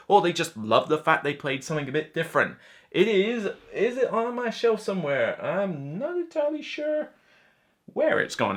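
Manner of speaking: usual speaking voice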